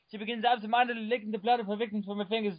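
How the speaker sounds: ordinary delivery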